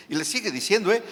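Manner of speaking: ordinary talk, nothing marked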